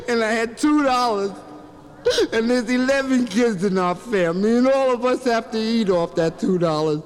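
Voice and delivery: mock weeping, stopping for sobs; crying kid. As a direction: sob out the story like a little boy. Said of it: "He was crying".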